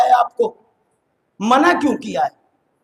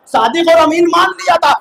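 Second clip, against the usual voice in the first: raised, louder preacher's declamation; emphatic repeat; 4 semitones higher, 4 dB louder than usual. Real, not acted. Preacher prodding the congregation to understand, emphatic and raised.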